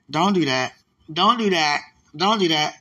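loud, insistent protest; loud mock plea to God; loud pleading. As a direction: beg him to stop, loudly.

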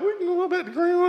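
lightly voiced wife, high and quick; imitating his wife. Pastor imitating his wife agreeing, high quick voice. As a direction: chirp it high and fast like her. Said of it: "she was like".

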